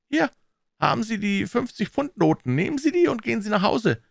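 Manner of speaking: high-pitched, pressing orders; commanding banker. Acted. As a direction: press money on him and dismiss him.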